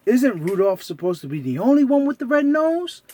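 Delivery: curious squeaky Mickey. Acted. Mickey asks Santa.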